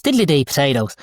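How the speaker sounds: put-on stock Irish accent. Shown as a demonstration. Showing a key phrase for accent work, in an Irish accent. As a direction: say it as an Irish catchphrase. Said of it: "for Irish".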